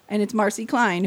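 ordinary talk, nothing marked

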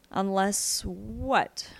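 drawn out and cautious; slow wary question. Acted. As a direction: stretch each word warily. Boy asks, slow and wary.

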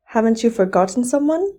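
ordinary delivery

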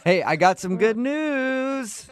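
high, slow drawn-out teasing; sing-song gotcha tease; cheesy radio host. Pretend. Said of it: "doing a phone tap".

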